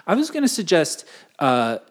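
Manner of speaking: ordinary delivery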